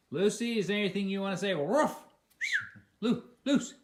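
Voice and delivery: high, loud call to the dog, with a woof. Real, not acted. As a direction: coax the dog Lucy to speak up, playfully. Calling to the dog Lucy.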